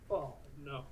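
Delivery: soft, deflated retold reaction; a quiet, sinking mock letdown; dismayed. Recalling watching a hopeful rival, quietly dismayed. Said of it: "I felt so bad".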